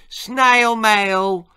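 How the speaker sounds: high, loud, stretched call; gleeful shout. Acted. Boys pushing snails through his letterbox, shouted. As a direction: shout it out like a prank delivery.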